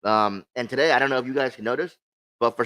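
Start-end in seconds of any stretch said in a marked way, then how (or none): none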